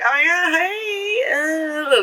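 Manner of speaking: slow, drawn-out, dazed sweetness; possessed dreamy voice. Retold or imitated. Girl under the spell greets him in a dazed drawl. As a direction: mimic her possessed trance, dragging it out. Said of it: "all of a sudden she's like".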